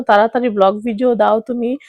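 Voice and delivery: usual speaking voice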